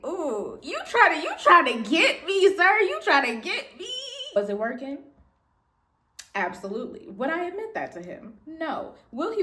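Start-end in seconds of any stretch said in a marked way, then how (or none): 0.1-3.9 loud teasing call-out. Retold or imitated. Teasing coworker about flirting.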